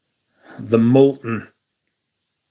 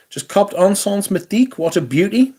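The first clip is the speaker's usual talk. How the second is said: high, bright, eager read; reading a chat message excitedly; 8 semitones higher than usual. Acted. Reads a viewer's comment about his perfume, excited. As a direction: read it like a thrilled fan.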